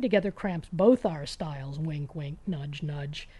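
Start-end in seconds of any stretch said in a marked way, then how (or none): none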